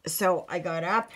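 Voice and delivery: ordinary delivery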